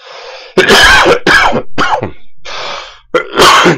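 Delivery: long rough coughing fit. Real, not acted. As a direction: hack through a chest cold. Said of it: "Désolé, je tousse un petit peu trop". (quinte de toux)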